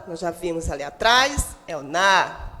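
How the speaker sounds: usual speaking voice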